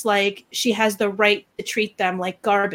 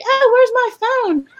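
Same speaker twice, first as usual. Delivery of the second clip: high-pitched mock helplessness; mock-distressed woman's voice; mocking imitation; 11 semitones higher, 3 dB louder than usual. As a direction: mimic her in a high, put-on voice.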